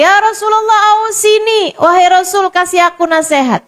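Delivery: high, insistent plea; young man asking the Prophet a second time; pleading young man. Retold or imitated. Young man asks again, pleading.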